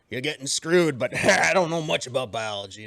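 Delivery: ordinary talk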